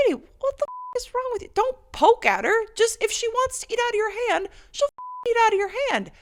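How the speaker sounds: high, loud indignant scolding of a stranger; outraged telling-off. Retold or imitated. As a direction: snap at the lady to stop poking. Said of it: "I'm like".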